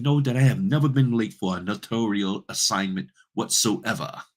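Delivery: haughty, affronted British outrage with swooping pitch; fake British accent. Offended notary refuses a rushed closing, fake British accent. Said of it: "my little fake British accent".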